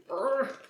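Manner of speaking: strained grunt twisting a lid; effort grunt. Real, not acted. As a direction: groan of effort, jar stuck. Struggling with second jam jar.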